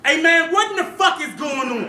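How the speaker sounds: mock heckle. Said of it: "this lady screamed out".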